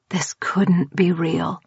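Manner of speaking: soft slow whisper. A narrator's reading. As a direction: murmur it in disbelief. Inner thought, unvoiced.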